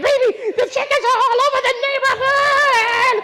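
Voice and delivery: high frantic shout down the phone; wife shouting to husband about loose chickens; panicked shout. Retold or imitated. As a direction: yell the news in full panic.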